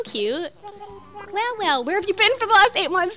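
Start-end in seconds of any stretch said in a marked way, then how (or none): 1.3-3.1 high, sing-song villager greeting. Acted. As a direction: read it as the cheery villager, pitched up and loud. Villager greeting read aloud.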